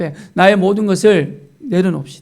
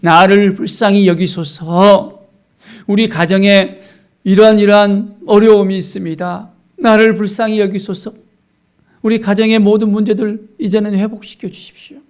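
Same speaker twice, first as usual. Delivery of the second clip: raised, pleading prayer voice; earnest prayer; 4 semitones higher, 4 dB louder than usual. Shown as a demonstration. Believer praying for a troubled family, earnest plea. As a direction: plead with God in a higher voice. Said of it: "간절한 마음으로 기도할 수 있겠습니까?".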